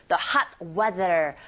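slow "the hot weather" with tongue between the teeth on "the"; not a Korean d in "the"; th sound. Shown as a demonstration. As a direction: brush the th between your teeth. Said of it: "the 긁어주셔야 돼요".

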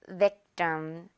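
victim low, second syllable a weak 뜸; ending like 뜸. Shown as a demonstration. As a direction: say victim front-stressed, T without air. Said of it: "뜸처럼 발음이 됩니다".

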